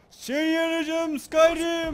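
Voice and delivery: high, loud, slow battle cry; loud, high, slow hammed-up war cry; mock battle shout. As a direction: bellow it like a dragon shout. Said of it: "Bağır".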